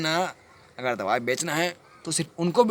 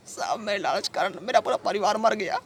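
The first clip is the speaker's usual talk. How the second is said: raised, anguished pitch; grieving confession; 7 semitones higher than usual. Acted. Man confessing to sahab that greed killed family, grieving. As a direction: let the grief lift the voice.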